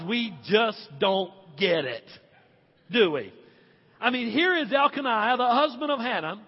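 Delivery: ordinary talk, nothing marked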